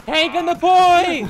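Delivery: loud, slow yelled warning; shouting callout. Real, not acted. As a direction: bellow it across the field. Callout under attack.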